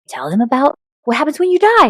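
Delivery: put-on high, eager kid voice; eager child. Son urging his mom to tell his classmates.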